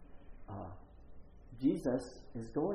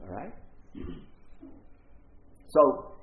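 ordinary delivery

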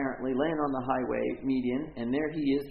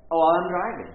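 dramatic realization; 5 semitones higher, 10 dB louder than usual. Retold or imitated. Realizing the seizure while driving.

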